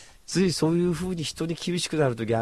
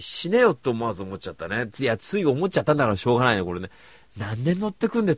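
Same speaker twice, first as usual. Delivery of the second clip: ordinary talk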